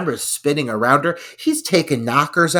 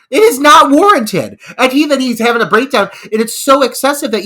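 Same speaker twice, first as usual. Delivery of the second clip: higher and louder, rushed exasperation; exasperated rant; 9 semitones higher, 7 dB louder than usual. Real, not acted. Host ranting about the panic attack, fast and loud. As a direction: rant fast at the absurd scene.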